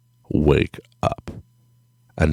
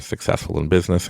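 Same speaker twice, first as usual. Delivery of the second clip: ordinary talk, nothing marked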